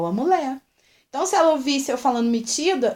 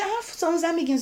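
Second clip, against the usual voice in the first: dismissive excuse; 6 semitones higher, faster than usual. Retold or imitated. Mocking daycare staff excuse.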